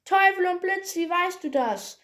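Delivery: high, loud witch's outburst; outraged witch. A narrator's reading. Witch curses at Peter's right answer, high outraged voice. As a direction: burst out high, cursing in disbelief.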